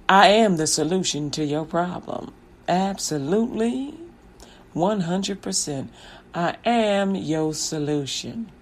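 slow, low, soft-sold confidence; self-assured pitch. Acted. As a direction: sell yourself quietly and slowly. Piper pitches himself to mayor.

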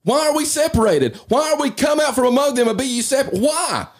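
fired up on too much coffee; amped up. Real, not acted. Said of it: "That coffee's jacking me up".

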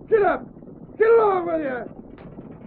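high holler, last call drawn out slowly; driving horses. Acted. Stage driver refuses to stop, hollering at horses. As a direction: urge the team on at a shout.

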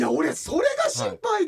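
high-pitched, worked-up pleading; insistent protest. Real, not acted. Host worried his story sounds made up, insistent protest. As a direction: protest high, anxious to be believed.

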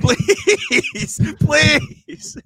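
high, loud hammed-up please; mock demanding yell. Host demands Apple reviews from listeners, mock yelling please. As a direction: shout the plea like a joke demand. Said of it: "I'm not asking anymore".

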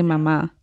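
"mama" stressed on the last syllable, as mamá; final stress. Shown as a demonstration. Co-host teaching by example, final stress on "mama". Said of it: "It's like when you say".

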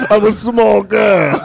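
put-on slow, drawn-out small-girl parody; mock girl voice. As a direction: overdo a helpless little girl. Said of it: "when you do the voice".

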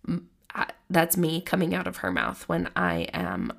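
usual speaking voice

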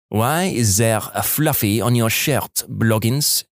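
slow French-Canadian sergeant's questioning; French-Canadian accent. A narrator's reading. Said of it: "female French-Canadian sergeant with this accent".

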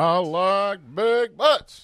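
slow, high, drawn-out preacher voice; Southern preacher voice. Acted. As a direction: stretch it out like a pulpit preacher. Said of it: "this is my Southern preacher voice".

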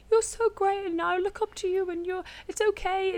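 high, sweet silly voice for her sister; squeaky mock sister voice. Quoting sister's letter in a silly high voice. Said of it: "I don't know why I did that silly voice".

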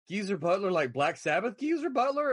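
double-check it in rising amazement; incredulous. Retold or imitated. Fan checking which [name] has come, incredulous. Checking the unbelievable news, retold.

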